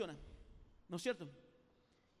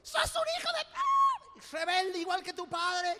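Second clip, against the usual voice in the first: high, loud, slow screaming fit; furious mother shout; 20 dB louder, slower than usual. Retold or imitated. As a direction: explode at the kid who dirtied the floor. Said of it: "le agarra un brote así".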